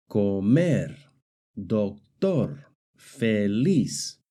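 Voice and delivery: comer, doctor, feliz stressed on their final syllables; stress on last syllable. Shown as a demonstration. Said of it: "the stress is on the last syllable".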